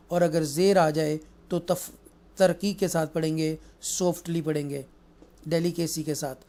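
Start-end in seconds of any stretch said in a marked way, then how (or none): none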